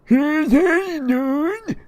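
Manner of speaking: loud, high squealed call; hammed-up shrill calling out; Tyler. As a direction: yell a greeting in a squeaky character voice. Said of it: "His name is Tyler".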